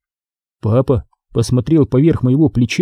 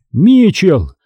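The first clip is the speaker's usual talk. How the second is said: mildly roared reproach, raised pitch; angry roar; 6 semitones higher, 4 dB louder, much slower than usual. A narrator's reading. Father to son at the car door, restrained angry roar. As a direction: exclaim in outraged disbelief. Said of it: "проревел он".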